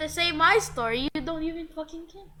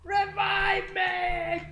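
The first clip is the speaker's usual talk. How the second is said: drawn-out pleading shout; 4 semitones higher, much slower than usual. Real, not acted. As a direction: beg for a revive. Begging teammate for revive.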